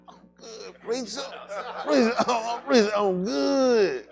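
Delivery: hammed-up church voice, slow at the end; mock churchgoer. Teasing a sweaty friend, in a hammed-up churchgoer voice. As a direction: drag out preacher like a sweaty congregant.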